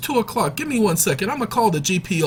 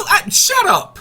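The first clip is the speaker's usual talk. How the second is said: higher, louder mock shushing; loud shut up; 6 dB louder, slower than usual. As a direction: shout them down playfully.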